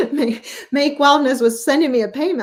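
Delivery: amused disbelief, laughing it out. Real, not acted. Laughing at surprise payment.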